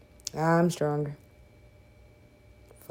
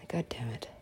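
whisper; 6 semitones lower, 12 dB quieter than usual. Real, not acted. Reacting to the game.

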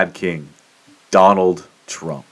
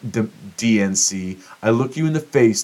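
ordinary delivery

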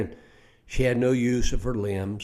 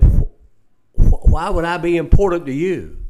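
louder and higher, stammering in disbelief; stunned disbelief; 6 semitones higher, 11 dB louder than usual. Retold or imitated. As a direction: she can't believe anyone needs her. Disbelieving old lady asks.

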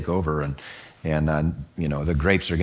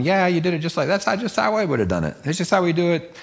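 high, eager voice of the crowd nodding along; excited audience chiming in agreement; agreeing villagers; 9 semitones higher, faster than usual. Retold or imitated. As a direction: pitch way up like eager listeners. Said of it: "they'd have been going".

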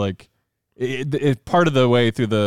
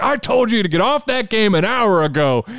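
yelling dad; 10 semitones higher than usual. Retold or imitated. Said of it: "his dad starts yelling at him".

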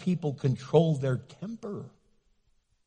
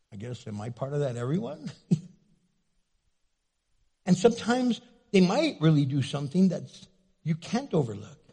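ordinary delivery

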